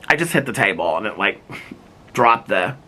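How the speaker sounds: ordinary talk, nothing marked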